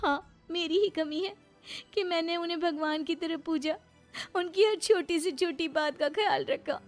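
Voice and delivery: high-pitched, hurt wife's lament; wronged wife, tearful. Acted. Betrayed wife lamenting her devotion, high and hurt. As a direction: plead in a high, wounded voice.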